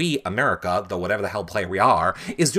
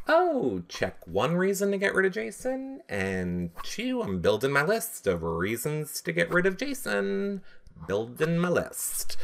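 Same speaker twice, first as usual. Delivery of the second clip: scheming aside; 6 semitones higher, 7 dB quieter than usual. Pretend. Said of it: "I'd be like".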